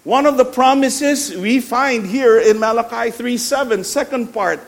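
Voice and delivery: loud, high-pitched sermon proclamation; ringing emphatic preacher delivery; full-force preaching. Acted. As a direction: proclaim it to the whole church with power.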